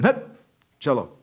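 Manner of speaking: sharp, high, loud dismissal; shooing away. Retold or imitated. Villagers turning away the penitent at the door, curt shooing. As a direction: imitate villagers driving someone off.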